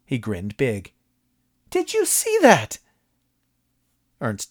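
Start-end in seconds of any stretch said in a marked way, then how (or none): 1.7-2.8 very high, loud thrilled question; a fan bursting with delight; excited high voice. A narrator's reading. Moss excited.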